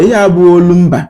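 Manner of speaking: normal talking voice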